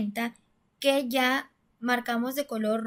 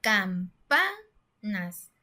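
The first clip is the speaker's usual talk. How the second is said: "Campanas" in slow separate syllables with a raised, stressed PA; stress on penultimate syllable; 3 semitones lower, much slower than usual. Shown as a demonstration. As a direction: slowly break up "Campanas", leaning hard on the middle PA. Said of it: "esa palabra se escucha adecuadamente".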